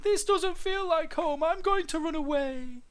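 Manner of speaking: high, loud lament; plaintive little dinosaur; crying out. A narrator's reading. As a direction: wail it as a hurt youngster. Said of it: "he cried".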